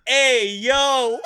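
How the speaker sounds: loud, high imitation of a toddler; toddler's catchphrase yell. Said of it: "he looked at him and he said".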